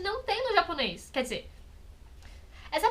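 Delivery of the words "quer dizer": ordinary delivery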